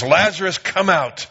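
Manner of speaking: mild commanding call; loud cry. A narrator's reading. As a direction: call Lazarus out with a full voice. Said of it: "he cried with a loud voice".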